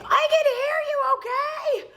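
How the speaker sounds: hammed-up screaming fit; acted screaming.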